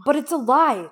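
high, loud indignant denial; insistent protest. A narrator's reading. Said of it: "John insisted".

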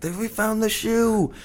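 high, slow, put-on crowd voice; shrill Monty Python-style crowd member; Life of Brian crowd voice. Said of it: "they're like".

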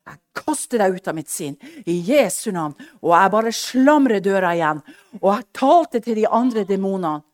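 loud, building rebuke of the demon; commanding rebuke. Retold or imitated. [name] casting the demon out in Jesus' name, loud. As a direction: cast it out with mounting force.

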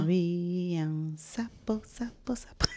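slow, hushed ghost-story whisper; spooky whisper. Pretend. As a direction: whisper it like a scary tale. Spooky echo of the toad story.

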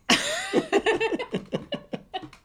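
shrieking high laugh; real cracking-up laughter; high burst of laughter. Real, not acted. Laughing hard at his cohost's accent.

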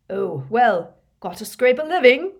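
blustering small-time thief; shifty petty thief. A narrator's reading. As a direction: brush it off like a cornered petty crook. Shifty thief brushes off questions.

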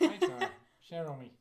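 high, laughing bad Irish; hammed-up Irish catchphrase; mock Irish accent. Shown as a demonstration. Co-hosts goof around on request, mock Irish accent. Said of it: "Say it in an Irish accent".

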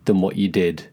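ordinary talk

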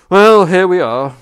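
loud, slow arrival call; hearty announcing. Acted. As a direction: boom out the arrival. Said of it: "said the Duke".

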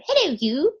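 high, sing-song royal greeting; Queen's greeting. Retold or imitated. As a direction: lilt it up high like a queen on the phone. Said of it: "that telephone greeting from The Crown that the Queen uses".

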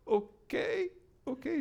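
high cracking voice, near tears; tearful okay. Retold or imitated. Mother answering the pastor, tearful and high. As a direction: let it break into a cry.

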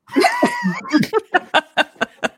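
high-pitched burst of real laughter; long high giggling fit. Real, not acted. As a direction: crack up at your own joke. Laughing at own joke about mother.

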